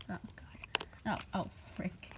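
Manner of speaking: panicked whisper under the breath. Real, not acted. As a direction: hush it, something just went wrong.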